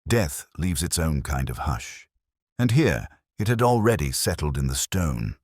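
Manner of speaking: ordinary delivery, nothing marked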